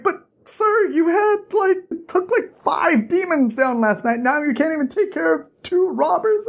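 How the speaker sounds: high-pitched pleading complaint; hammed-up disbelief; incredulous protest. Bast protests to hurt master.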